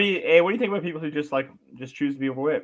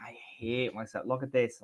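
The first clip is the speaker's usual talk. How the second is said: much softer, slower, lower mock despair; mock self-loathing; 3 semitones lower, 8 dB quieter than usual. Friend mocks someone hating their reflection, soft and low. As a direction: moan it like a defeated person at the mirror.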